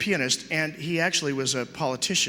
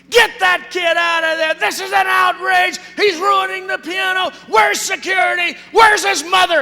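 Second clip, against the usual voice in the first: high, full-out indignant shouting; outraged crowd; 13 semitones higher, 8 dB louder than usual. Acted. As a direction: shout like an audience erupting in outrage. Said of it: "the audience just erupts".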